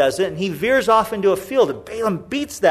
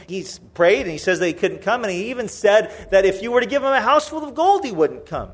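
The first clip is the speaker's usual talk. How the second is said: usual speaking voice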